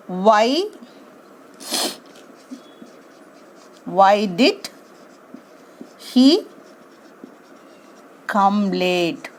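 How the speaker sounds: slow, spaced out correct question; question dictated with pauses. Shown as a demonstration. Teacher dictates the correct form, word by word. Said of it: "What we have to do?".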